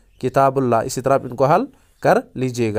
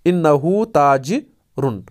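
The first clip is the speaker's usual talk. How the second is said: slow model answer; much slower than usual. Shown as a demonstration. Teacher showing students the answer, slow and careful. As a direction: slowly spell out इन्नहु ताजरुन word by word. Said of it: "तो यहाँ पर कैसे बनाएंगे?".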